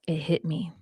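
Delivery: soft, near-whispered reveal; hushed revelation. Retold or imitated. Telling Chad of the sudden realization, hushed and near-whispered. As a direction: drop to a hush for the dramatic turn.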